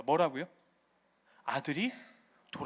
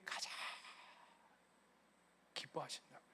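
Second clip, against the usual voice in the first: whisper; 8 semitones lower, 22 dB quieter, faster than usual. Acted. Sermon hushed climax.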